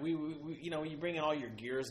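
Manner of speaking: ordinary talk, nothing marked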